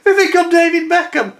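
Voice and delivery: very high, loud, incredulous cry; hammed-up desperate explanation; retold frantic shout. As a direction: yell it in mock panic. Retelling his frantic shouted answer.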